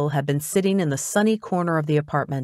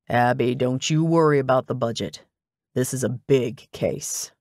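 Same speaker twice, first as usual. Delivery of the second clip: lower-pitched, matter-of-fact man's voice; bossy older detective; 4 semitones lower than usual. A narrator's reading. Detective instructing his assistant what to buy, bossy and low. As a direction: give orders as the no-nonsense detective.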